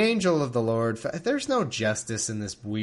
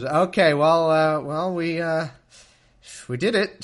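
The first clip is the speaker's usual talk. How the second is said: higher, loud, halting sheepish confession; sheepish Abram; 5 semitones higher, 8 dB louder than usual. Retold or imitated. Abram confessing to his wife, sheepish and halting. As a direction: fumble the admission like a caught husband. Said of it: "Abram goes".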